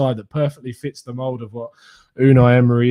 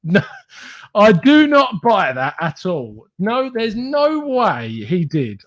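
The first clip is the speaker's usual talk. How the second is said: disbelieving; 6 semitones higher, much slower than usual. Real, not acted. Disbelief, drawn out.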